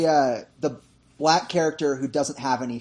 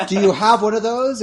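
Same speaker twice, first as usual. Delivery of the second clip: a touch louder, higher pushy ask; demanding question; 6 semitones higher, 7 dB louder than usual. Retold or imitated. Nick Cage asking the dealer, mildly pushy. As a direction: press the dealer for it. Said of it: "And he goes".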